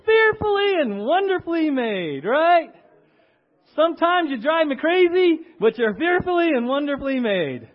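loud, high, gushing yay; excited cheer. Shown as a demonstration. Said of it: "Turn to the person next to you and say".

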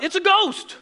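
loud, high-pitched mock panic; acted terrified yell, pitch way up; freaking out. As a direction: cry out in hammed-up alarm. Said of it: "They freak out".

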